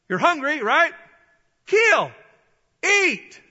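loud, high punched commands; commanding voice from heaven. Acted. God urging hungry Peter to eat, loud commanding call. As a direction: urge him on in loud single bursts. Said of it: "a voice came to him saying".

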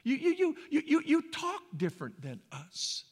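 high, loud, stuttered "you, you"; stammering onlooker. Retold or imitated. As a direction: sputter it out as a baffled outsider. Voices onlooker struggling to explain.

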